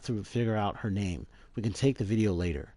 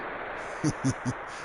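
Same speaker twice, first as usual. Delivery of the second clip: short voiceless villain snicker; a sly hiss of a laugh; creepy breathy chuckle; 5 semitones higher, 3 dB quieter than usual. Acted. Hojo snickering at the discovery, short and breathy.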